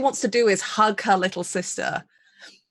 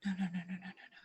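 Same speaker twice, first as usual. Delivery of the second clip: very soft, repeated murmur; fading, barely voiced refusal; hushed denial; 18 dB quieter, much faster than usual. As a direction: murmur it to yourself in dread. Character panics after hearing the news.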